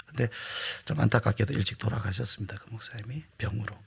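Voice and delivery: whispered sad aside; quiet mournful whisper; hushed whisper. Real, not acted. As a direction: whisper it with regret. Sad aside about death.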